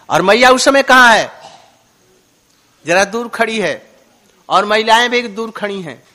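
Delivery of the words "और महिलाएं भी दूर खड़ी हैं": normal talking voice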